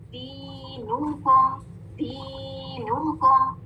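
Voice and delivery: slow दीनुकुम with long "dee"; two-count stretch. Shown as a demonstration. Teacher showing the counts, slow drawn-out recitation. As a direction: chant दीनुकुम slowly on a level note. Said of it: "दी टू counts देना है".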